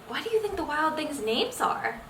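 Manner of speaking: usual speaking voice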